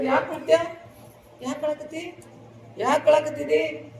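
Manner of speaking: high-pitched, imitated anxious questioning; insistent questioning. Wedding guests asking the weeping grandfather why, insistent high-pitched questioning. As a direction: play relatives pressing for an answer, pitched high. Everyone asks the crying old man.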